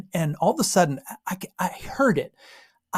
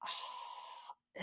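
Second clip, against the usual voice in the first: soft, voiceless "oh"; breathy sigh of realisation; 25 dB quieter than usual. Acted. It dawns on the traveller, hushed sigh. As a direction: breathe it out almost silently.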